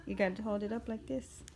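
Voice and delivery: normal talking voice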